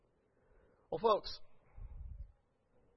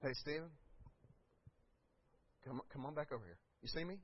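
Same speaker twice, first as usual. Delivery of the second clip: gentle God; 3 semitones lower, 9 dB quieter, faster than usual. Retold or imitated. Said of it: "crying out like that".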